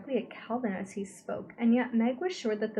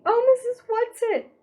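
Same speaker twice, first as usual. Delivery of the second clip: very high, loud despairing cry; despairing call; 11 dB louder than usual. A narrator's reading. Meg calling out despairingly for Missus Whatsit. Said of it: "Meg called despairingly".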